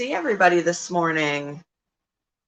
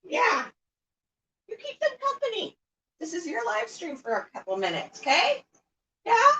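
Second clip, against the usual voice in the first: very high, much softer pet voice; talking to the bird; 6 dB quieter than usual. Real, not acted. Owner leaving her bird to keep viewers company, very high soft pet talk. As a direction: coo up high to the bird, gently.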